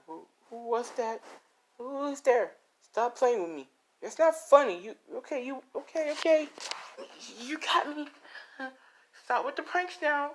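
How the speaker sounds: high, nervous pleading to someone unseen; begging them to stop the prank; scared pleading. Acted. Deku pleading with hidden prankster in the woods, high and scared.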